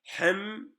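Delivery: "Hem" as a high, sustained closed syllable; stopping on the mim. Shown as a demonstration. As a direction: hold the m of "Hem", no vowel after. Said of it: "Sadece duraksıyoruz".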